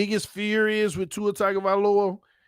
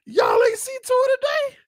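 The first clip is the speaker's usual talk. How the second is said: hype excitement. Real, not acted. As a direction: gush over the quarterback like a fan. Hyping the QB's workout video.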